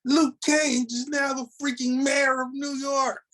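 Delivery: high, thrilled announcement; excited disbelief. Real, not acted. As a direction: burst out with delight.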